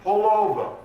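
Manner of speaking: loud, high shout to another driver; policeman's yelled order; yelling. Acted. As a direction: call it out through a car window. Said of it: "he yells to her".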